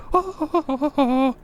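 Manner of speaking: high cackling laugh; high, mocking cackle; mocking knight laugh. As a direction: laugh at the fool.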